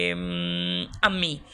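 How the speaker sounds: usual speaking voice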